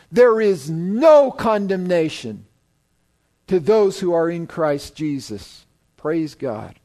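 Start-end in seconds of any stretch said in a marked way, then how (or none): 0.1-2.5 "no" punched louder and drawn out, slow; stress on no. Shown as a demonstration. Preacher to congregation, hammering the emphatic no. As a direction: lean hard on "no" in "There is no condemnation". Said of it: "He's making an emphatic point".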